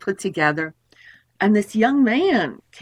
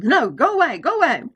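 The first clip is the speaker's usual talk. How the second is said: mock shooing; 5 semitones higher than usual.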